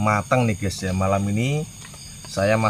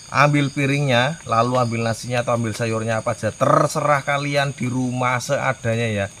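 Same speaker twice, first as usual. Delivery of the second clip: ordinary delivery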